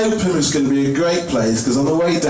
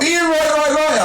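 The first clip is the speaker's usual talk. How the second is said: mock shout; slower than usual. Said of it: "some guy shouted out".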